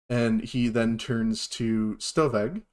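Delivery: ordinary talk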